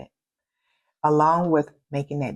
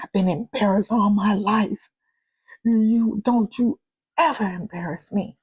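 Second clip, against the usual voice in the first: mild retold outburst; 5 semitones higher, 3 dB louder than usual. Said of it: "I did that whoopee thing".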